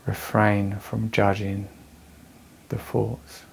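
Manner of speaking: measured meditation voice with long pauses; guided meditation. Real, not acted. Teacher guiding anxious listeners through breathing, calm meditation voice. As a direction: guide them calmly through the breath, leaving space between phrases.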